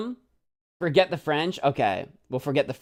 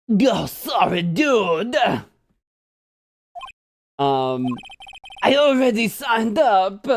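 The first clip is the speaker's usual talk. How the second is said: laid-back surfer dude, slightly higher; 5 semitones higher, slower than usual. Acted. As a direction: drawl it like a beach bum. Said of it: "Just do a surfer dude voice".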